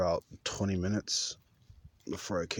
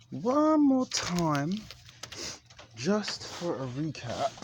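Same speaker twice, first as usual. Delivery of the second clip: sing-song; 10 semitones higher, slower than usual. Real, not acted. Drawn-out sing-song recap.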